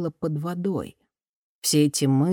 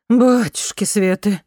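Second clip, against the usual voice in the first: shocked exclamation; 5 semitones higher, 6 dB louder, slower than usual. A narrator's reading. Drunk Marion sees May handcuffed.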